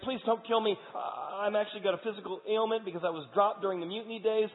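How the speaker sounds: high, loud desperate pleading; terrified plea for mercy to King David; begging for life. Acted. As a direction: beg the king not to kill you. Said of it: "He's like".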